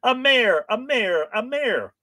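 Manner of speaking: high-pitched call and answer; loud opening question, easing into high chanted replies; pantomime. Acted. Reapers answering each other thrice, high pantomime calls. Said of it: "A little bit of pantomime here".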